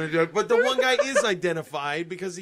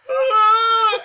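high loud wailing "Ah!"; imitating a mother's wail; mock wailing cry; 13 semitones higher, 7 dB louder than usual. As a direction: cry out like a grieving mother, hammed-up. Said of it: "the woman starts going".

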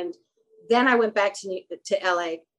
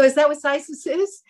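higher-pitched innocent question; feigned surprise; 7 semitones higher, 4 dB louder than usual. Retold or imitated. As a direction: act out a mildly astonished reply. Retold clueless reply to gown's designer.

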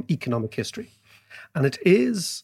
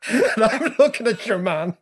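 laughing; 7 semitones higher than usual. Real, not acted. Still laughing mid-story.

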